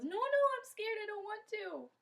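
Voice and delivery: put-on very high childlike plea; scared whining protest. Kondoma refuses the medicine man, scared whining protest. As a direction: beg not to be taken.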